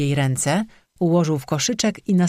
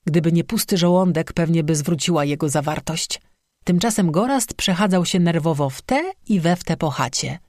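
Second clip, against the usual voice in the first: plain reading voice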